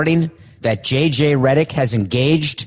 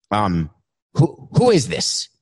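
normal talking voice

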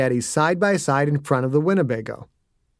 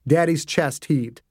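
plain reading voice

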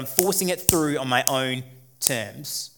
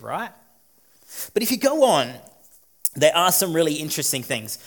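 normal talking voice

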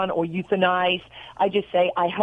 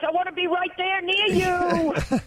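gushing affection; 9 semitones higher than usual. Real, not acted. Gushing to the hosts, playful.

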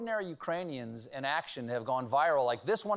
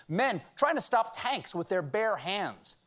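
ordinary delivery

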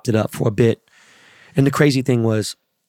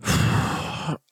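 long, breathy exhale; long sigh; 5 dB quieter than usual. Real, not acted. Host recalling hours of brutal rain, long weary sigh.

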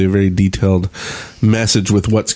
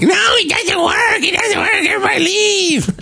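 much higher mock panicked wail, slow; mocking a panicking player. Mocking a complaining player's panic, high whiny mock shout. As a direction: ham up a whiny gamer's meltdown. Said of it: "freakedelic and all of his, like".

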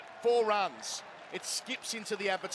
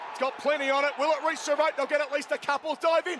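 rising higher and louder to a shout; building excitement; 6 semitones higher, 4 dB louder than usual. Real, not acted. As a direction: build from hope to a winning roar. Winning runs race to rope.

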